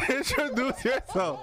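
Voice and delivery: high-pitched, laughing invitation. Real, not acted. Host teases bougie co-host at introduction, laughing. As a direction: crack up while handing over.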